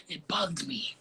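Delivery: confessing it in a whisper. Real, not acted. Guest admits her anger to the host, whispered. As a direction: breathe it out like a secret. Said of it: "I ain't gonna lie".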